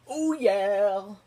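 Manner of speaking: loud, high "oh yeah" cheer; hyped cheer. Real, not acted. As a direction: cheer it out like a hype man. Hyped cheer after naming actor.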